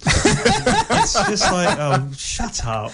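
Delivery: slow, laughing through the words. Real, not acted. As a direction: crack up at your own joke. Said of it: "laughing at own joke".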